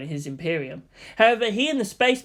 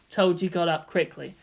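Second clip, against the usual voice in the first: ordinary delivery, nothing marked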